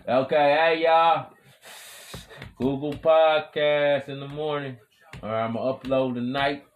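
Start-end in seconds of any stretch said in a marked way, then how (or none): 0.1-4.8 shouting the plug high and loud; loud hype shout. Real, not acted. Podcaster shouting a plug to his listeners, loud and hyped.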